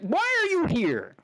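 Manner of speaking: hammed-up high-pitched rage; high mock outrage. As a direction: scream it at him, stretched out and shrill with disgust. Ranting at the grifter.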